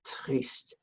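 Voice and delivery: soft, whispered sad triste. Shown as a demonstration. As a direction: breathe "triste" out quietly and sadly. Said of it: "Can you say that?".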